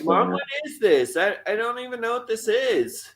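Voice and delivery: high-pitched mock child bewilderment; baffled little kid. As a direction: imitate a child appalled by greens. Voices child finding a sprout.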